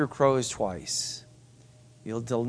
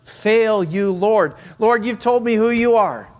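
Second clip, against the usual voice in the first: Peter's bold vow; 6 semitones higher, 5 dB louder than usual. Acted. Preacher voicing Peter's boastful vow.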